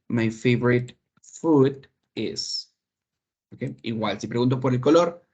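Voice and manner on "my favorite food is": slow, drawn-out model phrase for learners; slow model answer. Shown as a demonstration. Teacher modelling an English answer for Spanish-speaking students, slowly. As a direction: lay each word out slowly for the class. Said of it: "And what's your favorite food?".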